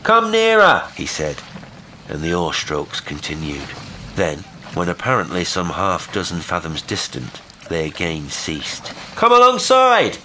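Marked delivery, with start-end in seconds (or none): 0.0-0.8 loud, high summons; calling out. A narrator's reading. Will hailing the boat. 9.2-10.2 very high, loud summons; exclaimed. A narrator's reading. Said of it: "exclaimed Will".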